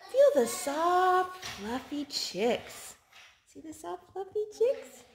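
high, slow sing-song read; reading to a toddler. Acted. Parent reading a touch book to a toddler, cooing. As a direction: coo the words to the child.

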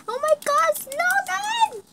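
very high, breathless squeals; fangirl squealing. Acted. The fan gushes over Logan and his pet, squealing high. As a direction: gush like a starstruck fangirl.

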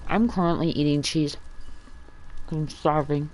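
mouth full. Real, not acted. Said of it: "speaking with my mouth full".

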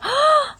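sharp, high intake of breath; pained gasp. Retold or imitated. Streamer re-enacts hurting while washing hair, pained gasp. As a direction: gasp as if a sore spot was touched.

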